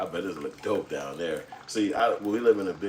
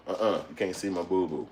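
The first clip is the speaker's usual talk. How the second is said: usual speaking voice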